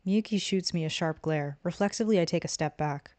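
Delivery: plain reading voice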